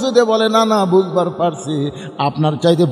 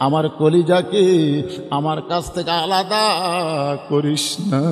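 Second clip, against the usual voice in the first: pleading lament; 8 semitones lower, 3 dB quieter than usual. Acted. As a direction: stretch each phrase into a chanted entreaty. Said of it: "এতিমের মতন দাঁড়িয়ে কাঁদতেছে আর বলতেছে".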